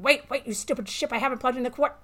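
loud, high panicked scream. A narrator's reading. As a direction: yell it at the ship in panic. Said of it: "you screamed".

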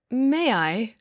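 usual speaking voice

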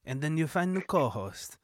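put-on soft, slow Israeli-accented voice; hushed Israeli-accent impression. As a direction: slip into a quiet Israeli accent. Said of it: "I'm the guy from Tinder Swindler".

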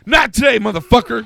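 loud, high cop shout; cop yelling. Retold or imitated. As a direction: bark it like a cop. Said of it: "this cop comes up".

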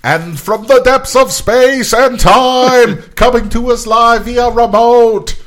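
booming, slow, epic announcer voice; old sci-fi announcer. Pretend. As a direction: announce it like a space opera trailer. Dramatic intro of remote guest.

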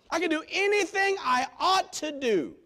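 loud, high proclamation; ringing sermon line; preached aloud. Real, not acted. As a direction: declare it to the hall. Loud repeat before whisper.

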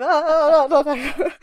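loud, high flustered "ah, ah, ah"; mock panic noises breaking into laughter; imitated panicked yelps. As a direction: yelp repeatedly as if caught out. Acting out scrambling to silence audiobook.

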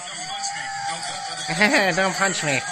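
fake-beg not to be punched; playful cowering plea; mock scared plea. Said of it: "you even think that".

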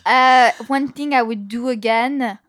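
slow, put-on French accent. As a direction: ponder slowly in a French accent. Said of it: "I'm gonna do a French accent".

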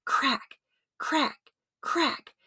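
sharp rising crack sounds; cane cracks. A narrator's reading. Narrator voices the cane hitting George, rising cracks.

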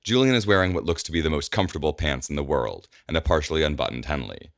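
ordinary delivery

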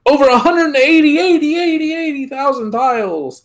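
high echoing repeat; fake echo. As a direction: mimic an echo effect. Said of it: "I think that was the wrong echo".

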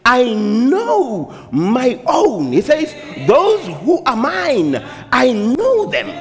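high preacher voice with long-held words; impassioned preaching. Acted. Preacher voicing the shepherd's words to congregation, impassioned preaching. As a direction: proclaim it, stretching "know" and "mine".

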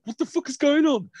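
high, loud startled shout; jolted awake and shocked. Retold or imitated. Mother waking to find son staring at her, startled shout. Woken by son at bed end.

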